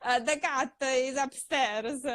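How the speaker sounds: mock her old Italian-accented phrasebook English; broken beginner English. Actress mimicking how she spoke English years ago, halting and amused. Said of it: "because I used to be like".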